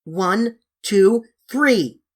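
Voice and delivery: counted yell. Acted. Children yell from church tower.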